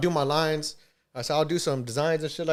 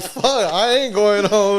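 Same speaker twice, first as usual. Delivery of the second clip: defiant fuck; 4 semitones higher, 6 dB louder, much slower than usual. Retold or imitated. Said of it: "And I'm like".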